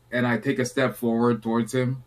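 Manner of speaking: normal talking voice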